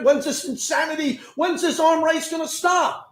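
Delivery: fed-up yell at the spending race; exasperated rant. Real, not acted. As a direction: demand an end to the madness. Host ranting about contract escalation.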